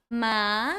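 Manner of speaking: MA rising in pitch; second tone. Shown as a demonstration. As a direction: let "ma" climb upward. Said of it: "Second tone goes up".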